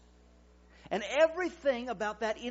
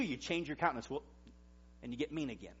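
ordinary talk

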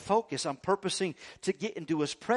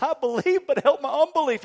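pleading, much higher and louder; desperate plea; 9 semitones higher, 8 dB louder than usual. Acted. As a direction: beg with everything you've got. Desperate father begging Jesus.

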